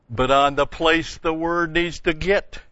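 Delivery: "the place the word needs to get" driven home high and loud; emphatic stress. Real, not acted. Preacher stresses his main point.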